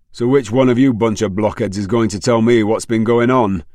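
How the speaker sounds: loud, stern scolding. A narrator's reading. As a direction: tear into them. Angry mother scolding children.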